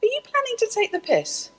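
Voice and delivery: high, mock-innocent quote. Asking the TV producers if they mean to mock. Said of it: "And I said".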